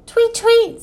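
high chirped tweets; bird tweet. A narrator's reading. Busy bird answering Ebb, tweeting. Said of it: "said the bird".

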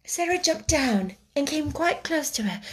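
ordinary delivery, nothing marked

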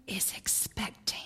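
breathy Southern whisper of expecting. Retold or imitated. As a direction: whisper it like a discreet Southern mother. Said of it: "she would whisper in her very Southern accent".

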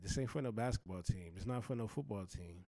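ordinary talk, nothing marked

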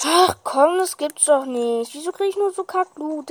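loud frustrated rant; frustrated outburst. Real, not acted. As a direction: complain loudly at bad luck. Frustrated at bad loot drop.

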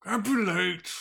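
loud, high orc yelling at the others to hurry; hurrying orc shout. Acted. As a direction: bark it out like a rushed orc worker. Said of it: "you overhear one of them saying".

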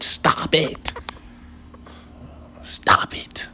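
voiceless, whispered telling-off; hushed scolding. Real, not acted. Telling off a bug at your ear, hushed scolding. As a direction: whisper it sharply at a pest.

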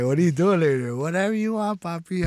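lightly imitated flirty exchange; a touch of put-on flirtation; mock flirting. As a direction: play a pick-up chat, pitch lifting on the answer.

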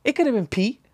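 high defensive blame on Pete. Retold or imitated. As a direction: nudge the blame away. Shifting blame, repeated.

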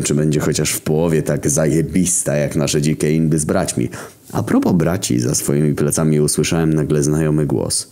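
ordinary delivery

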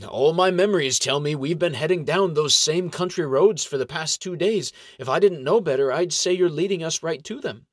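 hint that he's lying, slyly; suspicious gnome. A narrator's reading. Gnome monk accusing the ranger, lightly voiced and sly.

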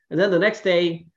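ordinary delivery

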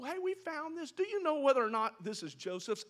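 high, breezy "Hey, we found this" with a questioning rise; casual suggestion. Acted. Brothers innocently suggesting to Jacob what happened. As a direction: toss it off lightly, letting him draw the conclusion. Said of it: "Just, you know".

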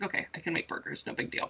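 Okay, I can make burgers, whispered under the breath to herself; breathy murmur, almost no voice; whispered self-talk. Retold or imitated. Quiet self-talk while cooking.

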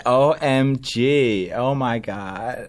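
very slow, drawn-out mock American; teasing impression in an American accent. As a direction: mimic an American host, stretching every word. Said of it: "I just did an impression of you".

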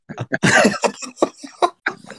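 wheezing silent laugh; airy high-pitched giggle fit; high breathless laugh. Real, not acted. Friend wheezes with laughter at the story.

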